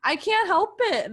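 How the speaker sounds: squealing. Retold or imitated. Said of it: "I was like".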